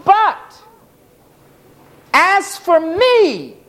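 the psalmist protesting, pitch climbing toward but; voicing the psalmist. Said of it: "He's saying".

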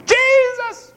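high ringing call of Jesus; shouted name. Retold or imitated. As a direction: call his name across the crowd. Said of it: "They shouted out loudly".

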